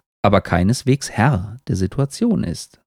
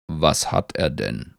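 low, slow, softer tree; 6 semitones lower, 6 dB quieter, slower than usual. A narrator's reading. Tree asks beetle about hurrying walker, low slow voice. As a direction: ask slowly as a deep old tree.

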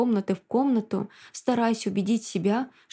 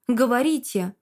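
demanding shout; 3 semitones higher than usual. Acted. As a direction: shout into the phone. Demanding answer on phone.

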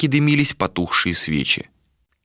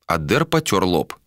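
ordinary delivery, nothing marked

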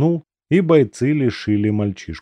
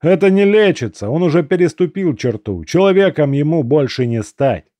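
firm raised voice; 6 semitones higher, 4 dB louder than usual. A narrator's reading. Comrade firmly refusing.

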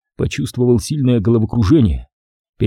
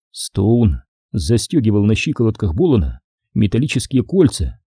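ordinary delivery

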